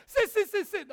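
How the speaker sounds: sit sit sit sit shouted, half laughing; loud, laughing sit, sit, sit, sit at a dog; loud command. Retold or imitated. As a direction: yell it over and over at the dog. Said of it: "我就大声叫".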